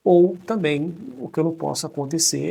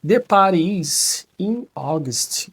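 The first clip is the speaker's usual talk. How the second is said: slow model sentence; 3 semitones higher, 5 dB louder, much slower than usual. Shown as a demonstration. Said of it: "ó".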